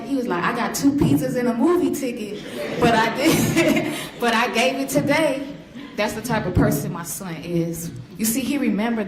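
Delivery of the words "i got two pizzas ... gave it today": talking through a laugh. Retold or imitated. Son telling mom about free pizza while laughing. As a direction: giggle it out, pleased with himself.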